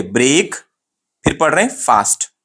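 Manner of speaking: "ब्रेक" and "फास्ट" as two separate full words; divided into parts. Shown as a demonstration. Teacher shows how students divide the word, splitting "ब्रेक" from "फास्ट". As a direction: split it: long "brayk", then long "faast". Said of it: "इस word को हम दो parts में divide कर रहे हैं".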